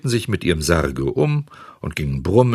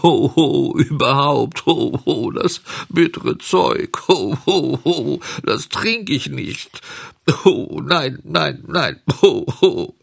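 high, hammed-up boo-hoo wailing; slow, high childish bawling in protest; sobbing grumble; 8 semitones higher, 3 dB louder, slower than usual. A narrator's reading. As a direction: grumble between loud, theatrical sobs. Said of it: "fing Bengele laut an zu schluchzen, und zwischen dem Schluchzen grollte er".

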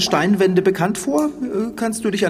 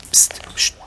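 whispered shush; urgent whispered psst. Acted. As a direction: hush your partner with a breathy whisper. Listening for the group.

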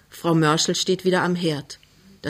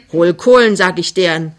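called-out order; 7 dB louder than usual. Acted. Mother repeats her order for coals, called out loudly. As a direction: shout the order at your daughter. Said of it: "rief die Frau".

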